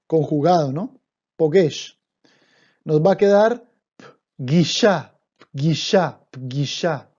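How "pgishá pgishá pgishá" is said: pgishá: P straight into G, no vowel; pgishá pronounced. Shown as a demonstration. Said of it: "Ojo, p". (pronuncia pgishá, la P sin vocal)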